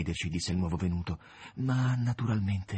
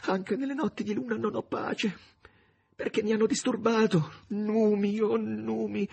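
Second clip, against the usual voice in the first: trembling murmur; 12 semitones higher than usual. Acted. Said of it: "cominciò a mormorare sussultando".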